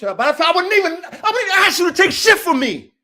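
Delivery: high-pitched heated protest; worked-up, pleading indignation; indignant outburst. Real, not acted. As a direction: raise the pitch, insisting hard. Indignant rant about loyalty.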